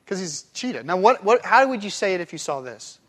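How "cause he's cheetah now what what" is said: ordinary talk, nothing marked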